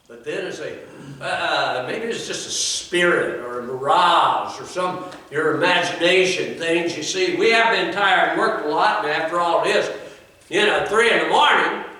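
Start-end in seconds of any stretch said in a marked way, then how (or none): 1.2-11.8 high, loud preaching build; preaching at full force. Acted. Preacher driving the story to his congregation, full force. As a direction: preach it out to the pews.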